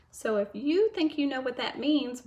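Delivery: ordinary delivery, nothing marked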